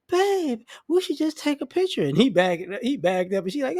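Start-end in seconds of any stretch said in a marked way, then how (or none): 0.1-2.0 put-on high, sweet girlfriend voice; high girly voice. Girlfriend coaxing her boyfriend before the bottle blows, high and sweet. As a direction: chirp it like a giddy girlfriend.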